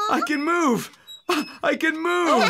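high, excited cry of relief; overjoyed at moving again; joyful. Acted. Tin Man freed from rust.

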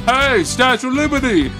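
ordinary talk, nothing marked

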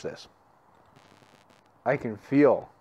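talking around pipe stem; pipe in mouth. Real, not acted. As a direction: teeth clenched on pipe. Seen 0: Clay pipe stem held between teeth from 0.8 to 6.5s.

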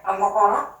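ordinary delivery

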